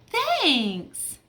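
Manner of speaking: high, bright little thank-you; chirpy thanks. Acted. Little friend thanking her pal, high and cheerful. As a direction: squeak out a happy thanks.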